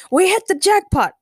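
high, loud jubilation; excited shout, easing on the last word; happy exclaiming. A narrator's reading. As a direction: crow it gleefully. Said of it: "Ray replied happily".

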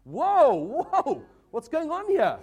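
high, loud, laughing surprise; laughing startled exclamation; surprised whoa. Retold or imitated. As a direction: gasp it out like you just caught something odd. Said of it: "And it was like".

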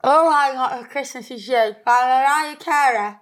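slurred through a mouthguard; wearing a mouthguard. Real, not acted. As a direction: voice going funny from mouthguard. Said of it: "Why is my voice going funny?".

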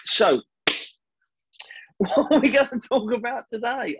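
can't stop chuckling while asking; real laughter breaking into the words; laughing. Real, not acted. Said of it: "I don't know why I'm laughing".

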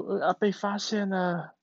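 caught panda dad. Acted. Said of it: "熊猫爸爸说".

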